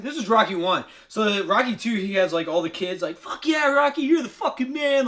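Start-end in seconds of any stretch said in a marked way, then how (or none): 3.2-5.1 high-pitched kids' cheer, imitated; excited cheering. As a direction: cheer him on like an adoring crowd. Kids cheering on Rocky.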